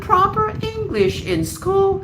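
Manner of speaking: very high, slow caricature of a parent; mocked complacent parent. Parent shrugging off teaching proper English, mocking imitation. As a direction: mimic a parent in drawn-out falsetto. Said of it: "because they're like".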